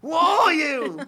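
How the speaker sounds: high, loud mock chef yell; hammed-up high-pitched British berating; Gordon Ramsay. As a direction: shout it like an outraged British chef, laughing. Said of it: "I just do Gordon Ramsay".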